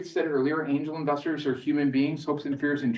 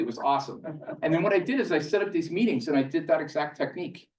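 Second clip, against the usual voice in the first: ordinary talk, nothing marked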